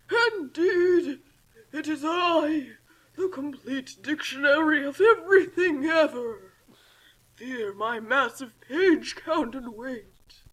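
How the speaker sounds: slow, loud booming villain voice; villain reveal. Acted. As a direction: proclaim it grandly like a villain. Dictionary reveals himself.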